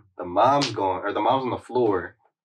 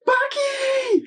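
a high, loud cry of his name, "Baki!" thrown out; yelling for Baki; 6 dB louder than usual. Retold or imitated. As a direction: scream "Baki!" for help. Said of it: "about to yell it".

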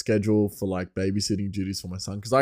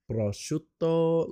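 slow, high Italian flourish on prosciutto; put-on Italian accent; 7 semitones higher, much slower than usual. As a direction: roll out the word like an over-keen Italian. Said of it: "and she would say, like, prosciutto".

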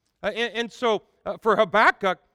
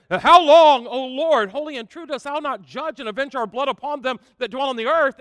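high, loud anguished cry; martyrs' loud, high plea to God; martyrs crying out; 5 semitones higher, 8 dB louder than usual. Acted. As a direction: cry out to God, pleading loudly. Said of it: "crying out loud voice".

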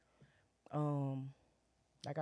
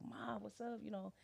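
son's greeting; 6 semitones higher, 9 dB quieter than usual. Retold or imitated. Son greets his mom warmly.

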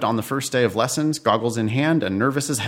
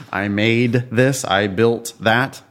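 slow, deliberate; 3 semitones lower, slower than usual. Shown as a demonstration. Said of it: "I want to learn about you".

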